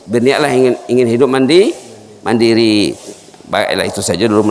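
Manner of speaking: usual speaking voice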